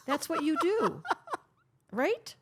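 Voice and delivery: bursts into a high laugh. Real, not acted. Host laughing at the guest's workaholic habit. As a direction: laugh through the teasing line. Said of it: "That's funny".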